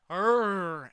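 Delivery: rolled "rrrr", loud; loud mimicked growl. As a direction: imitate a hype man's growl loudly. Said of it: "I'm going".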